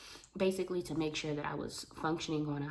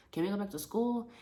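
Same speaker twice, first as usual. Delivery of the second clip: ordinary delivery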